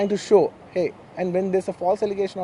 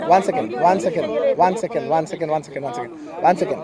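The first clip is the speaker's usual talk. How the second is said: urgent interjection; 5 dB louder than usual. Real, not acted. Cutting through clamouring reporters.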